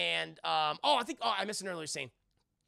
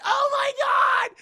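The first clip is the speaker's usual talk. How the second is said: very high, very loud, slowed shriek; mock terrified scream; 15 dB louder, much slower than usual. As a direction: scream it like a horror heroine. Heroine screaming.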